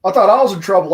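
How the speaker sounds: loud, high laughing relief; startled relief. Real, not acted. To Brandon after his sudden shout, loud relieved laugh. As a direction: blurt it out half laughing.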